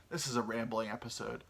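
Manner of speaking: ordinary talk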